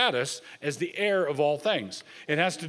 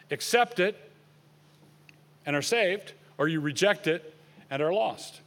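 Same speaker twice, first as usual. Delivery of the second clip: normal talking voice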